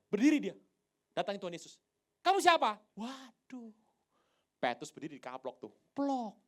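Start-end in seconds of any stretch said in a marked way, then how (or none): 2.2-2.8 loud, high shouted question. Retold or imitated. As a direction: bellow it across the room. Thomas shouts at Jesus.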